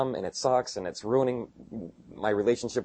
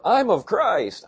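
mocking quote; 8 semitones higher, 4 dB louder, much slower than usual. Mocking factional church members.

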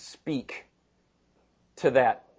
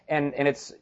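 usual speaking voice

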